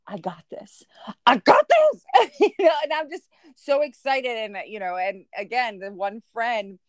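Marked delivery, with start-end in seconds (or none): 0.1-0.8 low, hushed pep talk to yourself; quiet self-assurance. Acted. Escalating self-talk, before the outburst. 1.3-2.0 high, loud burst of forced confidence; frantic self-assurance. Acted. Escalating self-talk turns frantic.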